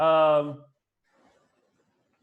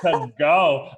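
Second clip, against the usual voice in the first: laughing mock Sarah. Host laughing while imitating Sarah brushing someone off. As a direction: play her as an annoyed diva, laughing.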